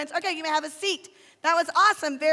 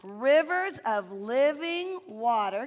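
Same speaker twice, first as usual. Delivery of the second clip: slow recitation; 4 semitones lower, much slower than usual. Shown as a demonstration. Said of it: "Can you say that with me?".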